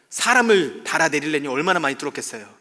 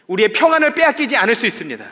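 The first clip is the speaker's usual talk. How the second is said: 평안을 hit much louder and higher; emphatic preaching; 6 semitones higher, 10 dB louder, faster than usual. Real, not acted. Pastor urges church members, loud emphatic preaching. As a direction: build to a strong declaration. Sermon repetition, building.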